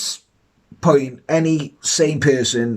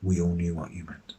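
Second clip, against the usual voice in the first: quiet; 9 semitones lower, 12 dB quieter than usual. Real, not acted. Teasing quiet aside.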